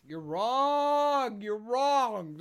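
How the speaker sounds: loud, drawn-out "wrong"; emphatic contradiction. Real, not acted. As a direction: shout them down, stretching the word. Emphatic disagreement with co-host.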